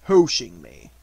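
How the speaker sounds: low, loud indignant surprise; gloomy donkey taken aback; surprised. A narrator's reading. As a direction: repeat it in deep disbelief. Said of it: "said Eeyore in surprise".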